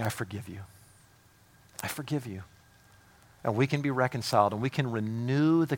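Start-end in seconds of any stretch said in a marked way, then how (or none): 0.0-2.4 very soft, lower, gentle reassurance; hushed, tender I forgive you; tender forgiveness. Retold or imitated. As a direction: forgive them softly and kindly. Jesus forgiving the repentant.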